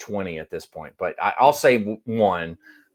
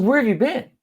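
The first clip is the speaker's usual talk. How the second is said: "Where" pushed much higher, insistent; exasperated repeat; 12 semitones higher, 3 dB louder than usual. Retold or imitated. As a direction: repeat the question, higher and sharper. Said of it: "I said".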